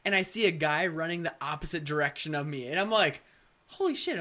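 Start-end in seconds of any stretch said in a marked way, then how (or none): 3.7-4.2 startled. Retold or imitated. Sees a player in game.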